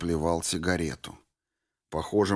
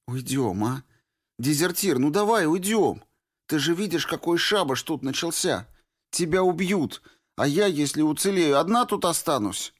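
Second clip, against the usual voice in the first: begging Norris; 9 semitones higher than usual. A narrator's reading. Girl begging the deserter to leave, higher and urgent. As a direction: beg him to flee with you.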